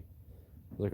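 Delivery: usual speaking voice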